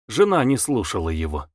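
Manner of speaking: usual speaking voice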